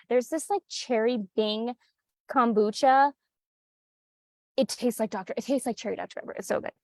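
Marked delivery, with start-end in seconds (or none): none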